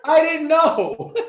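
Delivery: high laughing admission. Real, not acted. Guest laughing at his own ignorance to Crystal, high laugh.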